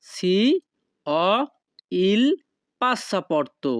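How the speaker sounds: each word set apart by pauses; slow separated words for learners. Shown as a demonstration. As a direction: spell it out one word at a time. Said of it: "তখন আপনাকে বলতে হবে".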